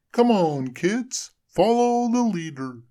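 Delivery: high, loud cheerful snowman; snowman leading. A narrator's reading. Frosty leading the children in a parade. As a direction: lead the kids on.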